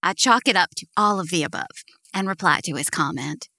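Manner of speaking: ordinary delivery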